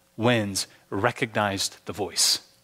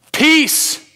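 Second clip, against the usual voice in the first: high, loud command to the storm; authoritative call over the waves; commanding shout; 12 semitones higher, 9 dB louder, much slower than usual. Acted. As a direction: order the sea to calm. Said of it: "he shouts to the wind".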